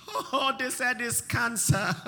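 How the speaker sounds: half-laughing imitation of panic; laughing mock patient. Mocking a frightened patient.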